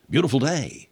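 a hint of Shatner's halting hero voice; William Shatner impression. Kirk looking up from chopping wood, a light Shatner. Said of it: "that's your William Shatner impression".

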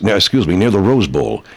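normal talking voice

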